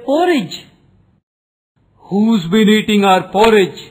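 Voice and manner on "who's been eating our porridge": indignant bear's raised voice, mildly done; understated shout. A narrator's reading. Bear parents find their porridge eaten, mild shout. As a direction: call out a storybook shout, held back. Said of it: "shouted the daddy bear and the mummy bear".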